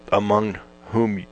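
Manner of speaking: catching a hiccup mid-reading. Real, not acted. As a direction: hiccups breaking the words. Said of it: "I got the hiccups here".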